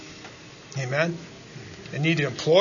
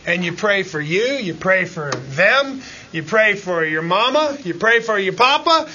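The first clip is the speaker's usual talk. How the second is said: ordinary delivery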